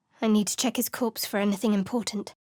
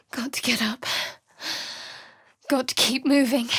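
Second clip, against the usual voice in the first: winded and weak; 3 semitones higher than usual. Acted. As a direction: drag yourself up, exhausted. Struggling up after being downed.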